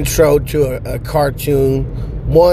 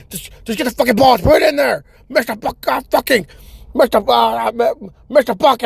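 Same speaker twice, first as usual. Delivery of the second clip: high, frantic mock-rocker yelling; hardcore guitar guy; 10 semitones higher than usual. Guitar guy ordering kids around, hardcore and high. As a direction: wail the orders like a crazed frontman. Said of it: "make it more fucking hardcore".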